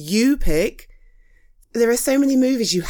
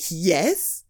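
usual speaking voice

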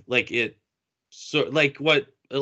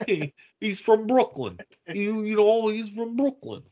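put-on thick Brooklyn accent, pitched up; 9 semitones higher than usual. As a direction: play a tough Brooklyn guy. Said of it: "doesn't have a Brooklyn accent".

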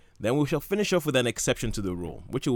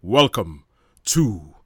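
booming, slow 1950s trailer voiceover; overly dramatic voiceover guy, loud and drawn out; movie trailer announcer; 2 semitones higher, 9 dB louder, slower than usual. Acted. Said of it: "my nineteen fifties movie trailer announcer guy".

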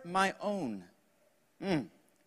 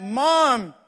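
higher, drawn-out call for Mom; boy crying out; 4 semitones higher, 3 dB louder than usual. Acted. Small boy calls for mom again, crying out. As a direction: call out like a kid from bed. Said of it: "The boy cries out".

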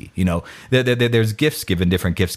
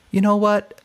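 slow realization; 5 semitones higher, slower than usual. Retold or imitated. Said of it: "I was like".

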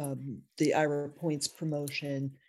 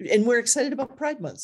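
excited; 7 semitones higher, 6 dB louder, faster than usual. Real, not acted. Host cheering Pride Month.

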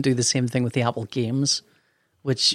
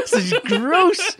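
laughing; much slower than usual. Retold or imitated. Retelling a gross encounter, laughing.

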